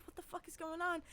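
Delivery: a hushed, baffled mutter in the dark theater; baffled under breath. Retold or imitated.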